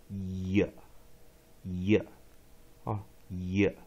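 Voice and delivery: "也" as one syllable, "yuh", with a Y glide; "也" starting on a consonant Y, not a separate vowel. Shown as a demonstration. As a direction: model "也" as a single low "yuh". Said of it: "因为它念也".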